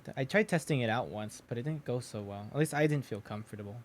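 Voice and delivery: usual speaking voice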